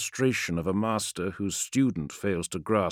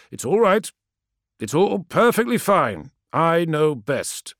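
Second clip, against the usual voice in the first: higher, louder, slow arrogant mimicry; proud mockery; 10 semitones higher, 8 dB louder than usual. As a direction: mimic the lord's smug pride. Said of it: "proudly stood by what he had done and said".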